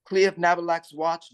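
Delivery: ordinary talk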